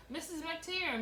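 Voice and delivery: put-on girl tattling, hollering for Mrs. McTeer. A narrator's reading. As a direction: shout the name up toward the house. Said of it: "Rosemary hollered".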